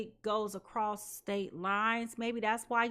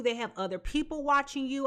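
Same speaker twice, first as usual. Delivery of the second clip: ordinary talk, nothing marked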